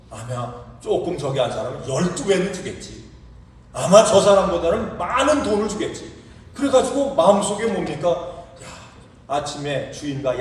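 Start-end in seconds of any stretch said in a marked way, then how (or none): 3.7-6.1 higher, louder gleeful hoping; picture the bigger pay coming; eager hope. Retold or imitated. Early worker silently expecting more pay, eager. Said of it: "마음속으로 야".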